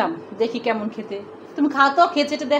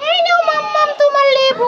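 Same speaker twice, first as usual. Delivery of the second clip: put-on higher-pitched pouty kid voice; sulky child character; 10 semitones higher than usual. Mother voicing a toy child who sulks off, higher pouty voice. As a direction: play the child toy stomping off in a huff.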